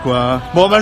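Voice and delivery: ordinary talk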